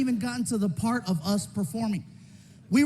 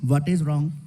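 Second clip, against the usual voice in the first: calm; 7 semitones lower than usual. Retold or imitated. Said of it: "As calm as can be".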